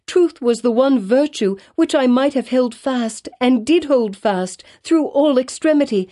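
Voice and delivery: high, urgent pleading, rising to a near cry; pleading confession. A narrator's reading. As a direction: beg forgiveness while confessing the truth. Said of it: "cried she".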